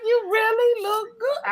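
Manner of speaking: very high, slow, drawn-out imitation; incredulous compliment. Retelling the driver repeating his compliment, incredulous. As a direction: stretch it out in high-pitched mock wonder.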